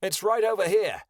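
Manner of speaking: high, loud call. A narrator's reading. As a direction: call them over from a distance. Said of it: "Kyoto called".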